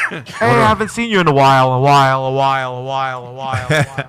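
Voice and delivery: words repeating in a fading, low echo; gynecologist echo impression. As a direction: mimic an echo dying away in a cavern. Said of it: "this is my impression of, uh, Hillary Clinton's gynecologist".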